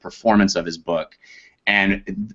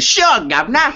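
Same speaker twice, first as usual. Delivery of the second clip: put-on cheeky, pitched-up cliché English accent on governor; mock British accent; 4 dB louder than usual. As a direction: ham up a stagey London accent as a joke. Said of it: "Can you do an English accent?".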